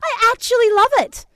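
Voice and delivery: put-on high, loud child voice; happy little boy. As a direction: play the thrilled little boy. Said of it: "He goes".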